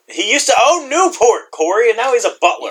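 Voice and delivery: mock dramatic lament. Real, not acted. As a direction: wail over his fall from fame. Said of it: "repeated lament".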